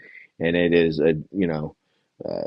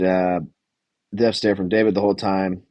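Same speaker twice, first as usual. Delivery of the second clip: usual speaking voice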